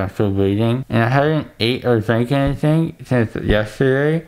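talking around dental gauze; mouth stuffed with gauze. Real, not acted. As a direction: numb swollen mouth speech.

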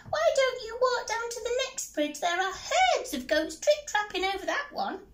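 high bouncy rabbit voice; little rabbit. Acted. Rabbit answers the troll under the bridge, high squeak.

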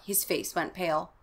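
ordinary delivery, nothing marked

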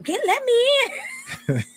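very high, rushed let me in; wife begging husband to let her in, very high; panicked plea. Retold or imitated. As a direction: scramble to get in before he drives off.

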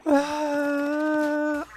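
high, loud happy exclaim; thrilled. Real, not acted. Streamer celebrating a finished level, high loud delight. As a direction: gush about the level up high.

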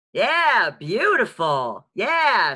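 loud, high, enthusiastic approval; delighted praise. Real, not acted. Teacher praising a student's facial expression, delighted and loud. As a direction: cheer them on, stretching the words.

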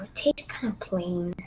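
hushed whisper so the server can't hear; whispering a complaint about the lunch to a classmate. Pretend. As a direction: breathe it out as a secret to a friend. Whispering a complaint about cafeteria food.

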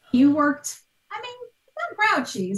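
ordinary talk

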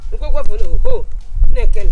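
loud, exasperated calling out; loud rant. Acted. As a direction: complain loudly to everyone. Ranting about litter on road.

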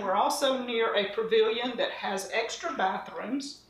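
ordinary delivery

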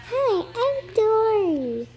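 high, slow little-fish voice; squeaky baby Dory, drawn out. Acted. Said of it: "Let's start with Baby Dory".

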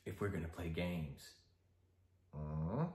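soft, low, teasing Joker; icy polite interrogator. Acted. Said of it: "interrogation scene involving ... the Joker".

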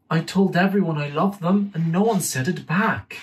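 put-on high, disappointed small boy; sad little boy voice. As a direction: complain like a hurt child. Sad child Dimitri voiced.

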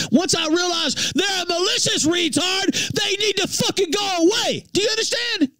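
high, heated rant. Real, not acted. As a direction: climb up in anger at him. Ranting at a chatter.

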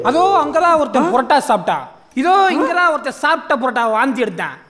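high, loud mock-spooky parody; mocking imitation. Guest parodying the horror tales, mocking. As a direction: ham up the tour guide's warning. Parodies the caretaker's death stories.

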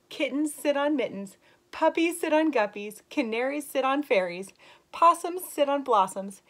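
put-on slow, lilting read-aloud voice for kids; story reading. Teacher reading a rhyming book to young kids, lilting. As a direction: read the rhyme brightly to the class. Reads picture book to children.

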